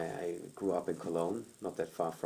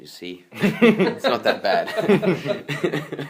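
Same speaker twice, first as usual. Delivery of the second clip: slow, laughing through the words, much louder; 8 semitones higher, 13 dB louder, much slower than usual. Retold or imitated. Teasing his girlfriend after the call, laughing loudly. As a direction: chuckle it out slowly, teasing.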